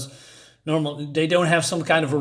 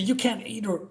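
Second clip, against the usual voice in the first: put-on boy lashing out at his guardian, higher and higher; angry outburst; 5 semitones higher than usual. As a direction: pitch climbing higher, angry and near tears. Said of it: "he's getting a little bit angry".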